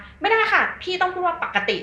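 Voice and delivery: high, loud pedantic objection; hammed-up scolding correction; mock correcting. Acting out correcting someone's speech.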